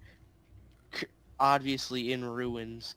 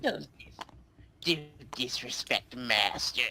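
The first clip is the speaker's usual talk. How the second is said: goblin voice; much slower than usual. Acted. Goblin pleads for master.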